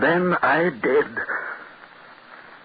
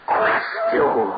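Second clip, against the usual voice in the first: anguished cry. Acted. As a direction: let the horror burst out. Climax of the horror.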